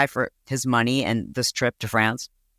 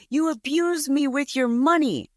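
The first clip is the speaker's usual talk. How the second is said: mock screaming fit; 11 semitones higher, slower than usual. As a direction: imitate an outraged woman, high and slow. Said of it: "having a screaming fit".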